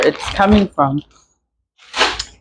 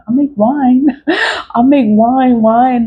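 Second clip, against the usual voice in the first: laughing; 6 semitones higher, slower than usual. Real, not acted. Laughing about making wine.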